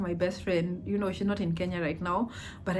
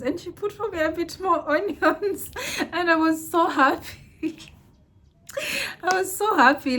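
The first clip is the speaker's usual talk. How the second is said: much higher, laughing through the words; giddy laughing joy; 11 semitones higher, 5 dB louder than usual. Real, not acted. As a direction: bubble over with delight, giggling. Overjoyed after getting more onions.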